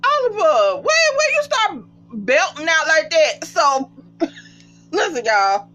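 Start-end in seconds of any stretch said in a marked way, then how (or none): 0.0-3.4 excited holler. Real, not acted. Vlogger calling to Oliver about his belting, excited holler. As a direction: call out to him in delight.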